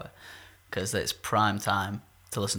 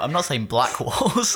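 loud, higher, slowed by laughter; laughing protest; 4 semitones higher, 6 dB louder, slower than usual. Real, not acted. Host defending the remark about walls, laughing loudly. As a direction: protest through real laughter.

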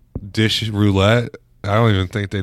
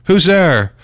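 mock call from the back; 9 semitones higher, 6 dB louder than usual. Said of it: "She's like".

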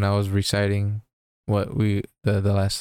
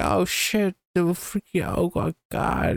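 higher hammed-up alarm, slowing at the end; mock panic; 9 semitones higher, slower than usual. Doc is shot by the bad guys, in hammed-up panic. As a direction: fake dying panic, pitched up.

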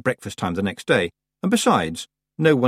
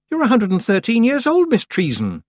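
protesting; 13 semitones higher, 5 dB louder than usual. A narrator's reading. Tiffany protesting.

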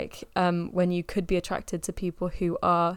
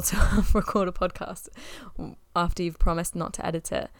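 ordinary talk

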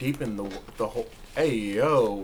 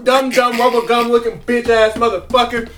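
loud mock rant; 6 semitones higher, 8 dB louder than usual. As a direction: yell the insults, cracking up. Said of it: "I will love loud enough".

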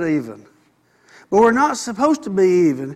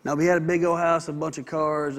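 normal talking voice

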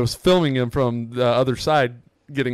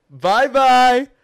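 high, loud sing-song taunt; mocking send-off; 12 semitones higher, 7 dB louder, slower than usual. Retelling his taunt to departing Yankee fans, mocking send-off. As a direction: jeer them out in a raised voice. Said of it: "I'm just like this".

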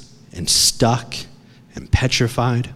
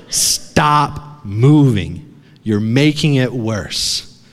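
very slow, drawn-out command; father's firm instruction; much slower than usual. Retold or imitated. Father telling his wedged son to hold still, slow and firm. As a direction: stretch each word to halt someone's struggling. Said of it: "he's like".

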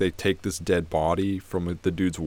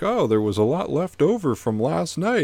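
higher, louder lightly voiced wife; wife's cheerful reply; 7 semitones higher, 5 dB louder than usual. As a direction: chirp the answer back. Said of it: "she's like".

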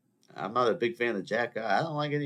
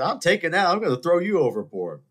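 loud, higher, hammed-up grab; snatch the diamond and threaten her, loudly; mock tough threat; 9 semitones higher, 7 dB louder than usual. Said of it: "I would have said".

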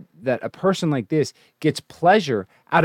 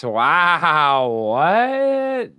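surprised disbelief; 3 semitones higher than usual. Real, not acted. Reacting to a channel's video title.

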